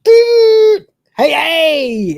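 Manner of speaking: very high, loud clownish hey hey; clown cheer. Retold or imitated. Rimmer greeting Lister, hammed up high and loud like a clown. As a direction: yell it like a party clown. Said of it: "he literally goes like".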